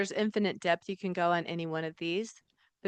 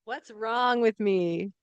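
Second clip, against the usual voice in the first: louder, higher, slow self-pity; mock whine; 4 semitones higher, 6 dB louder, slower than usual. Audience joining the game, hammed-up self-pitying whine. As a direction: moan it like a victim. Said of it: "they ask the wrong questions".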